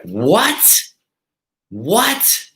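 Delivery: high, rising disbelieving "What?"; can't believe the accusation; mock stunned double take; shocked disbelief. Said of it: "now I lost it".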